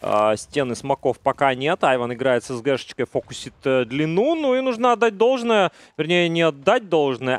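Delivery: usual speaking voice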